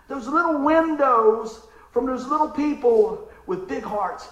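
loud, slow preaching; full-force preaching. Acted. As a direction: preach it to the back row.

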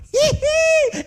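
a high little hee-hee giggle; mock giddy giggle. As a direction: giggle like a delighted kid. Giggling at a cherry.

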